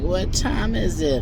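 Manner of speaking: slow, drowsy mumble; half-asleep son asking the time; sleepy voice. Retold or imitated. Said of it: "his Sleepy Pie voice".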